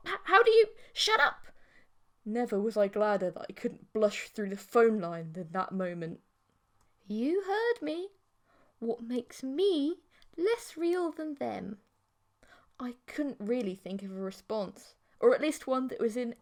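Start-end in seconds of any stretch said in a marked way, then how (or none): 0.1-1.5 high, louder embarrassed outburst; flustered shout. A narrator's reading. Flustered protagonist. 7.1-11.8 slow, higher, hushed challenge; slow teasing girlfriend. A narrator's reading.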